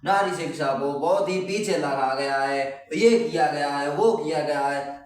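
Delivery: slow, drawn-out singsong recital; singsong essay dictation. Shown as a demonstration. Teacher showing students how to pad an essay, singsong. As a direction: reel off filler lines, stretching the endings. Makes up filler essay sentences aloud.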